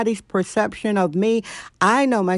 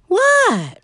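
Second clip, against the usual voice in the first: high-pitched "what" dropping sharply; squealed pet-talk question; animal voice; 14 semitones higher, 4 dB louder than usual. Real, not acted. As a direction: call to a pet in a high, falling voice. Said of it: "That's my animal voice".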